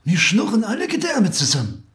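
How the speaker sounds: very high, loud lament; exaggerated high-pitched animal complaint; hungry fox. A narrator's reading. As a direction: whine about hunger up high.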